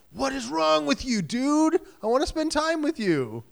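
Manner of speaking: the clingy partner whining for attention; whiny extrovert spouse. Retold or imitated.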